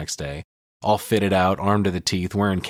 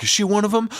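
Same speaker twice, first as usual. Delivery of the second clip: pitched way up, mock-panicked kid; high, alarmed kid's question; imitating Sam; 13 semitones higher, 3 dB louder, faster than usual. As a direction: squeak it like a scared little brother. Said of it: "He's like".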